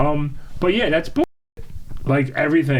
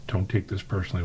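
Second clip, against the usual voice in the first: quiet warning; 5 semitones lower, 7 dB quieter than usual. Retold or imitated. Quiet warning before the outburst.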